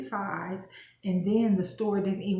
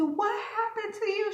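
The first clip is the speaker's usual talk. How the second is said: imitating the nail lady; 14 semitones higher than usual. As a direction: ham up the lady's high gasp of disbelief, laughing. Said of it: "And she said".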